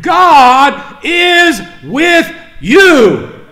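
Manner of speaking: God is with you, very slow, loud and high; assuring the congregation in full voice; slow proclaimed blessing. Acted. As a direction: stretch every word out over the crowd. Preacher proclaims to hurting church.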